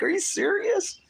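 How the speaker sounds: sudden high squeak of disbelief; astonished "are you serious"; incredulous. Retold or imitated. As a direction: leap up in pitch, stunned. Recalls own shocked reaction.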